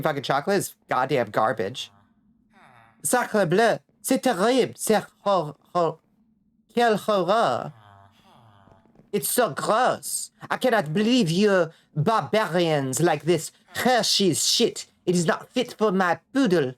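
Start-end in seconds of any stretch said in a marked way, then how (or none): 3.0-7.7 mock outraged Frenchman, higher pitch; hammy French snob horrified; French accent. Mock French outrage over chocolate. 9.1-16.7 slow disdainful French snob; French accent. Pretend.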